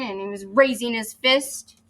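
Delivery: ordinary delivery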